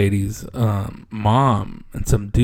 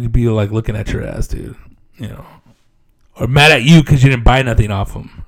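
ordinary talk

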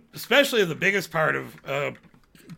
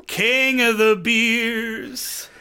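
slow, grand mock announcer; mock slogan voice; 3 semitones higher, much slower than usual. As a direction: drawl it like a sad ad. Mock slogan of poor salesman.

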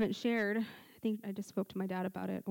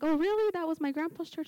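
high-pitched, louder "oh, really?" reaction; retold surprise; 8 semitones higher, 4 dB louder than usual. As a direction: replay your astonishment at the coincidence. Retelling surprise at a shared connection.

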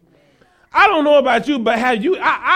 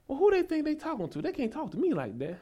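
indignant muttering; 3 semitones higher, 12 dB quieter than usual. Acted. Preacher acting out hurt pride at being talked to, indignant muttering. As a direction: grumble it under your breath, much softer.

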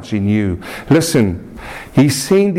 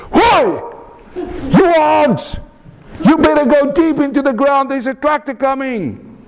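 very high-pitched acted shout to the ants; man yelling at ants about danger; shouted warning; 14 semitones higher than usual. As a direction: call out a warning in a raised, high voice. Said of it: "he shouted louder".

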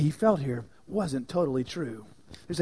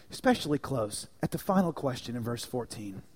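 usual speaking voice

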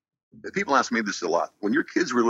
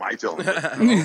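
Lion-O voice; 7 semitones higher, much slower than usual. Shown as a demonstration. Shows off Lion-O voice.

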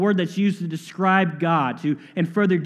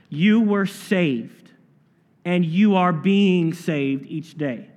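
very slow, weighty preaching; slow emphatic; much slower than usual. Real, not acted. Pastor presses the point on the church, very slowly. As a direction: lay each word down slowly. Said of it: "Hear me on that".

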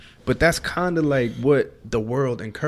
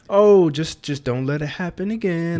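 lightly voiced lenient parent, higher at first; 6 semitones higher, 4 dB louder than usual. Parent letting son off easy, mock lenient. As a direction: brush it off like an easygoing dad. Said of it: "you're saying, like".

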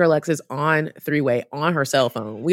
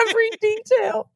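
panicked. Retold or imitated. Panicked confession to doctor.

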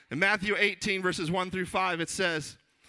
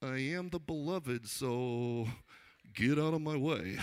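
softer, slower self-satisfied put-on voice; smug John; 3 semitones lower, 4 dB quieter, slower than usual. Beloved disciple dismissing his rivals, quietly smug. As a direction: understate the brag, taking your time. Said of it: "I imagine John was sitting there".

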